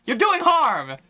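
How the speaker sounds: high, loud mock yell; mock exasperated accuser. Streamer mimicking an accuser dodging questions, mock exasperated yell. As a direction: throw it back, hammed-up exasperation. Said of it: "And they go".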